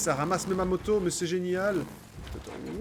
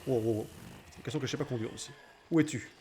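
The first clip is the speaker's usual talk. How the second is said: normal talking voice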